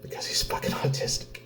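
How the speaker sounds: hissed under the breath, voice dropped to nothing; bitter whisper. Real, not acted. Father telling viewers why kids bullied his son, in a bitter whisper.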